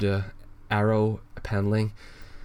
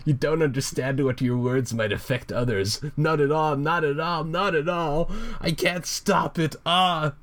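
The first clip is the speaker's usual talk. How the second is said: high, melodramatic villain rant; Joker; 8 semitones higher than usual. Acted. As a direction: build to a pitched-up, self-pitying mock meltdown. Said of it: "the Joker rant continues".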